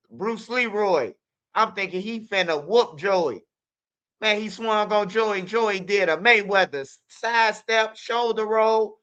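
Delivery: ordinary talk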